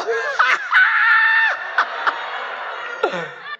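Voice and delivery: very high, long helpless laugh; shrieking laughter. Real, not acted. Preacher overcome by Jesus' boldness, laughing very high. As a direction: squeal with laughter until it runs out.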